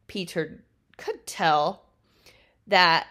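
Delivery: ordinary delivery, nothing marked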